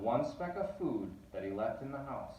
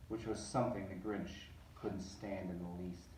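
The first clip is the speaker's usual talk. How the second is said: low grumble; 3 semitones lower, 6 dB quieter than usual. A narrator's reading. Narrator darkly voicing the Grinch's disgust, low and soft.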